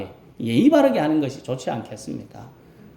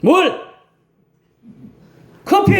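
curt, higher, louder barked order; bossy demand; 13 semitones higher, 5 dB louder, slower than usual. Retold or imitated. Elderly member ordering a younger deaconess, curt demand. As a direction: snap it out like an entitled elder. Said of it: "너무 당당하게 요구하는 것 같습니다".

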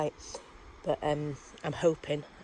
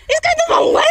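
much higher and louder, a shriek of alarm; panicked thought; 11 dB louder, faster than usual. Retold or imitated. Retelling to her friend her panic that the train went the wrong way, alarmed. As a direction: relive the moment of panic.

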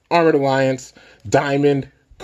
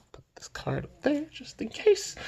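whispering; 10 dB quieter than usual. Real, not acted. Quiet aside while setting up.

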